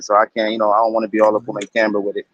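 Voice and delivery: talking around a blunt; blunt in mouth. Real, not acted. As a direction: lips holding a blunt, words half-blocked. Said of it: "I got a blunt in my mouth".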